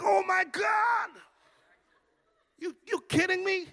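hammed-up, incredulous wail; appalled disbelief. Preacher hears pastors are her best customers, appalled wail.

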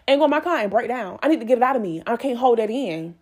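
ordinary talk

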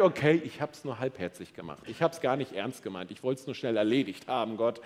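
a hint of guilty excuse-making; lightly voiced sheepish admission. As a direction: own up casually, dropping the pitch. Voices Cain's inner admission to God.

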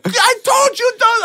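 high shouted scold; mock shouted driver; angry driver. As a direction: yell it like the furious driver. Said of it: "He's like".

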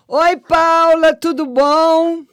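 high, loud, slow hello; bright greeting. Real, not acted. Host greeting a caller on the live, loud and slow.

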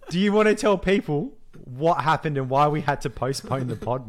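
normal talking voice

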